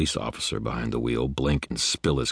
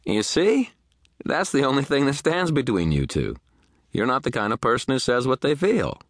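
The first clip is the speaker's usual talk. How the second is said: high, triumphant delight; pleased and emphatic, pitched high; beaming; 11 semitones higher, 4 dB louder than usual. A narrator's reading. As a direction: crow it happily, voice way up. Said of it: "Michael beamed".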